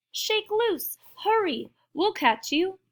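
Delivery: high-pitched urgent calls; shouting. A narrator's reading. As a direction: urge a friend on from below. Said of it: "the prairie dog shouted".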